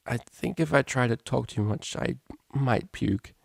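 halting voice of someone feeling sick; queasy, hungover. A narrator's reading. Hungover unicorn answering the cellist, queasy. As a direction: talk as if about to throw up.

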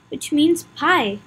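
usual speaking voice

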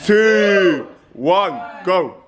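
loud high countdown call; countdown shout. Real, not acted. Counting down the challenge start.

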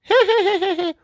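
high, far-off women's cackle; distant cackle. Acted. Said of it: "Off in the distance".

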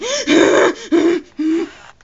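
loud exaggerated heavy breaths; hammed-up heavy breathing noise. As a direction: huff and puff noisily. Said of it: "Heavy breathing".

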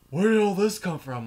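alarmed question. Retold or imitated. Neighbours finding blood everywhere, startled high question. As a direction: gasp it out in alarm. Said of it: "And everyone's like".

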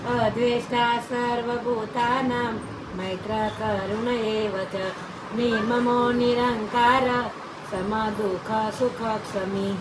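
loud chanted Sanskrit verse; shloka recitation. Real, not acted. Grandmother reciting the morning verse on request, loud chant. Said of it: "શ્લોક સુના દીજીએ".

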